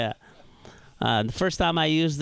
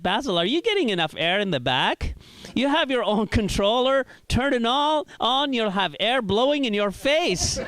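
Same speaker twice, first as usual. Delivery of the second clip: a touch higher, eager host friend; lightly voiced helpful friend; friend offering; 7 semitones higher than usual. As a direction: friend in the front seat offering the air controls, a bit higher. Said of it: "He's like".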